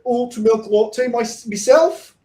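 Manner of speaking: high, loud hammed-up Irish accent; attempted Irish accent. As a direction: belt out a bad, high-pitched Irish brogue. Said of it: "you have to do the accent".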